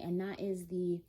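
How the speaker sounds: normal talking voice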